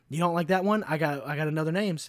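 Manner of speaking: ordinary delivery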